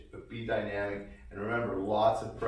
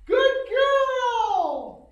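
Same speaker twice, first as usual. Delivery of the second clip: put-on very high, loud, gushing good girl; excited sky-high praise voice; high-pitched praise; 11 dB louder than usual. Shown as a demonstration. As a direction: squeal it up high to praise the dog. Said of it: "Don't be afraid to use that high-pitched voice".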